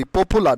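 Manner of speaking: "popular" said po-pu-lar, plain p and u; the wrong "popular", short "pu" without a y-glide; common mispronunciation. Shown as a demonstration. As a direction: say "popular" as po-pu-lar with a sounded final r. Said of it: "আমরা ভুল উচ্চারণ করি".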